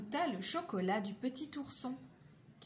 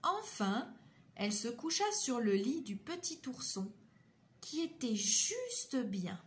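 ordinary delivery, nothing marked

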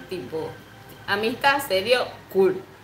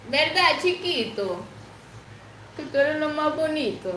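higher pitched doting voice for the pet; 8 semitones higher than usual. Real, not acted. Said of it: "Yo le hablo así".

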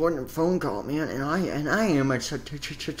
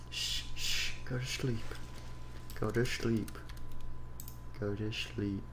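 soft lullaby hush; 3 semitones lower, 9 dB quieter than usual. Acted. Soothing someone to sleep.

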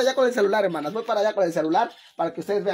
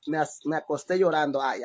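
ordinary talk

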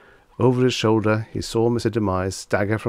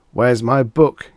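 slow, loud, higher panicked gasp; the sudden shock of a lost book; alarmed and louder, drawn out; 4 semitones higher, 8 dB louder, slower than usual. A narrator's reading. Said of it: "he gasped".

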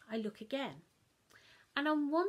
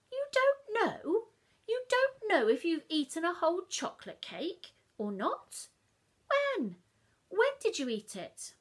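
much higher, louder exasperated mum; incredulous Mum; 8 semitones higher, 9 dB louder than usual. Acted. Mum scolding child about the cake, loud and high. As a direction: scold in disbelief.

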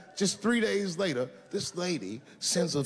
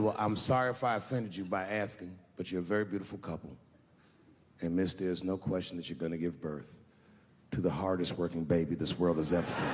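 mock polite apology; 8 semitones lower than usual. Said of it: "I said".

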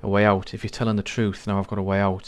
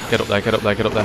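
being chased; 7 dB louder, faster than usual. Real, not acted. Urging the character during a chase.

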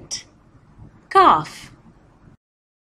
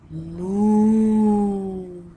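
low drawn-out moo; cow moo; 4 semitones lower than usual. Shown as a demonstration. Teacher showing kids a cow, low mooing. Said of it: "A cow says".

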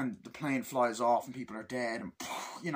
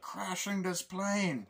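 high, slow villain voice; Bane; 7 semitones higher, slower than usual. Acted. Villain revealing his plan, high slow impression. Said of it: "he's more threatening as Bane".

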